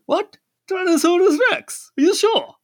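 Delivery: put-on very high, loud Indian-accented disbelief; hammed-up incredulous dad with an Indian accent. Indian dad questions his kid about the dinosaur, in high, loud Indian accent. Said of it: "doing an Indian accent".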